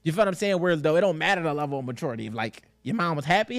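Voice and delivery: normal talking voice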